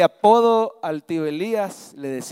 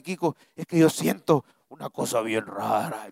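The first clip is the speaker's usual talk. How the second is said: strange possessed voice; 5 semitones lower than usual. Retold or imitated. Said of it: "pero con una voz bien".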